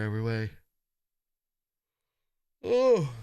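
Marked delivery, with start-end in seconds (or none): 2.6-3.1 surprised. Real, not acted. Sudden realization.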